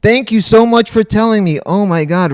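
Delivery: effusive thanks. Real, not acted. Streamer thanks viewer Reagan for saving the stream, effusive thanks. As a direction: thank them loudly, with real relief. Grateful after learning of muted mic.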